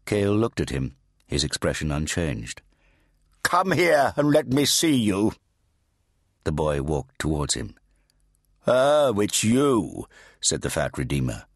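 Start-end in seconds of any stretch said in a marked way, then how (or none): 3.4-5.3 put-on high, slow, commanding old priest; fat Redeemer. A narrator's reading. 8.7-10.1 put-on high, slow, commanding old priest; fat Redeemer. A narrator's reading.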